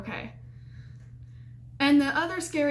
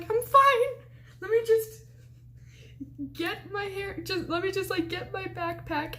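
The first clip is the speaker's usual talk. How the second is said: panicky insistence; 8 semitones higher than usual. Retold or imitated. Shaken student trying to leave the room, squeaky panic. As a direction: imitate being badly rattled, pitched way up.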